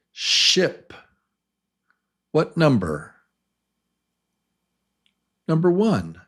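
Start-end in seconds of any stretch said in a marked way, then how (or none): 0.1-0.9 "Ship" said high and breathy with a short I; clear test word. Shown as a demonstration. Teacher gives a listening test word, short vowel. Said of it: "let's try a different word".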